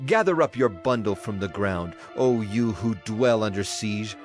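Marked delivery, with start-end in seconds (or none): none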